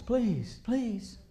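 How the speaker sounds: soft, mild imitated plea; begging. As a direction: Noah pleading gently for souls. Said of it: "he begged and pleaded".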